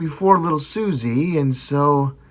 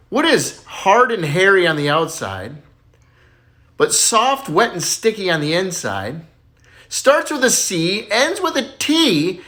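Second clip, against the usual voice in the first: teasing riddle; 4 semitones higher, slower than usual. Acted. [name] slowly poses dirty riddle.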